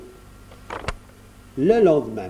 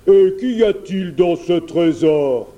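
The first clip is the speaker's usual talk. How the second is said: loud, raised bear voice; curious bear; 4 semitones higher, 6 dB louder than usual. A narrator's reading. Bear asks the prince about treasure, curious. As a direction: ask greedily as a storybook bear.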